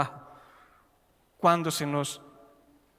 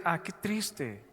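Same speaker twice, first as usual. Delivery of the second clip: soft, much quieter sigh of pity; mock sad sigh; 7 dB quieter, much slower than usual. Preacher voicing a driver who pities a funeral, softly sighing. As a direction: breathe it out softly like a sad onlooker. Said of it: "Uno por lo general no dice".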